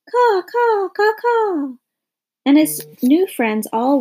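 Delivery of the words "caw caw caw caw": high cawing calls of a crow; crow caws. A narrator's reading. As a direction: caw out like a crow circling overhead. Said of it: "he called out".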